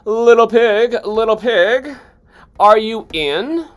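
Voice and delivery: high, loud call at the door. Acted. Wolf calling to the pig at his straw house. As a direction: call sweetly through the door. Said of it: "so I called".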